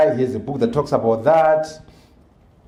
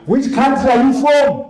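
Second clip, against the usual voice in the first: high mocking voice of condescending forum delegates; mock them, pitched high, breezing fast through "Professor, very interesting"; imitating dismissive delegates; 10 semitones higher, 3 dB louder than usual. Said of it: "delegates pressing him again".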